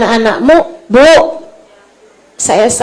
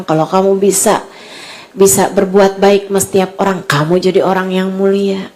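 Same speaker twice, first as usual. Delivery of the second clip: low, softened preaching voice; tender preaching; 5 semitones lower, 4 dB quieter than usual. Acted. Preacher tells mothers where true honour lies, tenderly. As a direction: counsel gently in a lowered, even voice.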